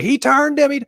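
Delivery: loud, high-pitched mock scream; imitated screaming commentator. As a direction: scream it like an excited race commentator. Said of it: "would be screaming at the mic".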